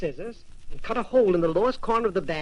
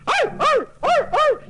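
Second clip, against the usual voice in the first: yapping dog barks, much higher and louder; 6 dB louder than usual. A narrator's reading. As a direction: bark like a small dog. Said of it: "barked the dog".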